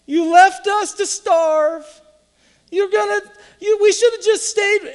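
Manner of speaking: high, loud and slow lament; whining complaint. Retold or imitated. Israelites complaining to God, high loud whine. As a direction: moan that you should have stayed.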